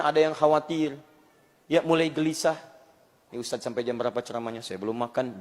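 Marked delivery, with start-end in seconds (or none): 3.3-5.4 soft, quick grumble of a hungry listener; a hint of a whiny hungry congregant; mock hungry complaint. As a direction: mutter it fast like someone starving.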